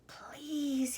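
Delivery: high, hushed whisper; pleading whisper. A narrator's reading. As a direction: whisper it as a secret plea. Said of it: "whispered to the two old women".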